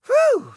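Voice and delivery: high relieved whew. Acted. Collector relieved the visitor did not say garbage, whew. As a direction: let the breath out in relief.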